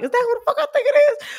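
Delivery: high, fast disbelieving whisper-like thought; disbelieving inner question. Retold or imitated. Friend retells wondering if it was the star, high and fast.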